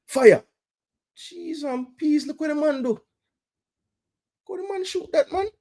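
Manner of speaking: real thrilled fan; excited shout. Real, not acted. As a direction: shout at him to shoot. Excited urging of shot.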